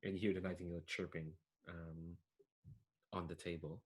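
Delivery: ordinary talk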